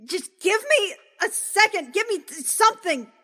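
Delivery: high, loud panicked pleading; backed against a wall, begging for space; frantic high cry for room; panicked plea. Acted. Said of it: "she's just gonna be like".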